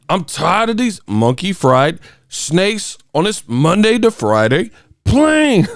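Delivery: high, slow, hammed-up dubbed voice; mocking a bad TV overdub of a movie line; mock TV-edit overdub. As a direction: overact the silly censored replacement words. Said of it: "He's like".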